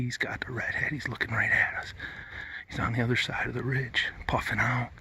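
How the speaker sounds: hushed hunter's whisper; wildlife watching whisper. Real, not acted. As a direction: breathe the words, keep it still. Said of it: "This is where we gotta be real quiet".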